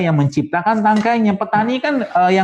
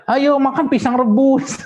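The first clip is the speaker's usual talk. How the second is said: put-on higher, slow sing-song coaxing of a fussy child; sweetly urging the kid, pitched up and drawn out; coaxing parent; 10 semitones higher than usual. Parent urging a child to eat boiled banana, mock coaxing.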